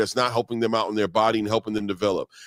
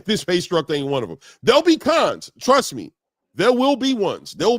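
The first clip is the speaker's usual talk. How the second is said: fired up; 7 semitones higher, 3 dB louder than usual. Real, not acted. Heated point, raised pitch.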